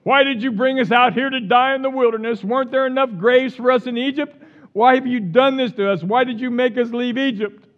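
loud, high mock whine; hammed-up griping crowd; reading the Israelites' complaint to Moses in a whiny voice; complaining Israelites. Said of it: "And they said to Moses".

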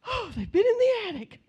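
high startled cry of alarm; shocked gasp. Retold or imitated. A preacher acting his alarm at the coven's attic, shocked. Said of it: "at first I'm like".

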